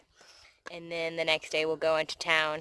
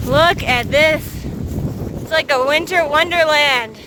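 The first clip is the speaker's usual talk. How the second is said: shouting over wind; 8 semitones higher, 8 dB louder than usual. Real, not acted. Windy snowy ridge, shouting over wind.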